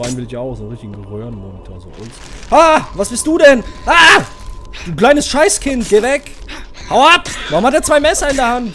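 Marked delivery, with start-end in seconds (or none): 2.5-8.7 much higher, loud terrified yells; jump-scared player screaming at the enemy; real panicked screaming. Real, not acted.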